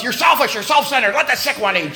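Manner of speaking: high, rapid-fire mock scolding; wife berating the animals, hammed-up; imitated scolding yell. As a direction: scold them in a high, indignant voice. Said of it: "yelling at a hundred and fifty pound bucks".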